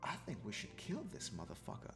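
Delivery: quiet. Retold or imitated. Quoting Destiny's suggestion.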